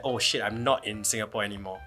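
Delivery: normal talking voice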